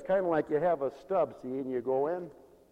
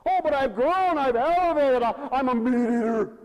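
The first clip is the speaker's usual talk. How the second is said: loud, high-pitched self-important believer; mock boasting; 11 semitones higher, 11 dB louder than usual. Pastor mimics believer who claims to have grown, boastful. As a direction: puff it up as a loud, mocking brag.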